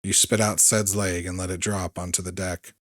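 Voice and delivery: ordinary delivery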